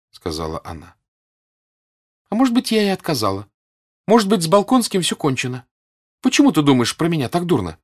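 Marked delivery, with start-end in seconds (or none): 2.3-7.8 high, raised, provoked tone; agitated, high-pitched counter; defensive challenge. A narrator's reading. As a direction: retort as if stung. Natasha defensively challenges Sonya.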